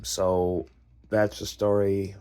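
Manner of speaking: ordinary talk, nothing marked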